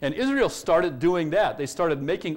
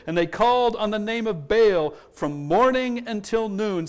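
ordinary delivery

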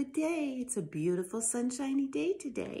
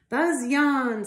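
buzzing blade; 11 dB louder than usual. Acted. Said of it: "screamed the blade".

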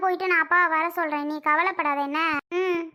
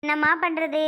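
normal talking voice

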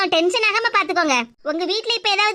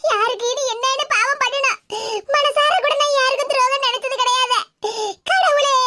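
grandmother's wail; 6 semitones higher than usual. Acted. Grandmother laments to God.

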